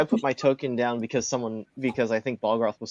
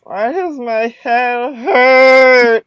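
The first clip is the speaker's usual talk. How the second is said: high, slow, groggy little kobold; dazed kobold voice; 11 semitones higher, 9 dB louder, much slower than usual. Acted. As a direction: whine it out, confused and hurting. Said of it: "He goes".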